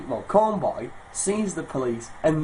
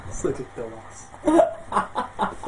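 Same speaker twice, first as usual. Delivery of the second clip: laughing mock mum; 4 semitones higher, much slower than usual. Mum scolding son, laughing.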